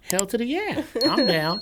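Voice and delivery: high, loud eager yes; excited. Retold or imitated. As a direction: jump at the offer. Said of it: "I'm like".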